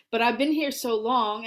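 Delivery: usual speaking voice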